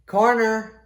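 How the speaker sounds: high shouted "Corner!"; busboy call. Shown as a demonstration. Busboy shouting to the kitchen staff, high call. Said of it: "I'll prove it".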